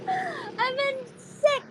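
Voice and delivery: high, drawn-out squealed complaint; odd put-on voice. Real, not acted. Complaining to a friend about being sick, in a high drawn-out voice. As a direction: stretch it out in a high, slow whine. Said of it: "Why are you talking like that".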